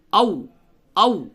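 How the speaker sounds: او hit firmly with a pressed w; forceful doubled waw. Shown as a demonstration. Teacher shows the shadda on waw, forceful او. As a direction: press hard into the w of او. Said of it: "بہت ہی شدت کے ساتھ".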